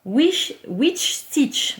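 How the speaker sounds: "Witch Stitch" dit lentement, avec un accent anglais appliqué mais approximatif; accent anglais hésitant. Shown as a demonstration. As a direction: articule le nom anglais avec soin, à la française. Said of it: "j'ai pas un super accent anglais". (prononciation anglaise lente et appliquée)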